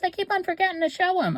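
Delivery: high, quick quoting of her daughter; daughter's fretful line, voiced high and fast. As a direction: pop up high and rush it, mimicking her daughter. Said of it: "She goes".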